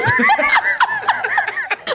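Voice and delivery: high, shrieking laugh; helpless laughter. Real, not acted. Singer cracking up over the Patricia joke, shrieking laughter. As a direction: burst out laughing, real.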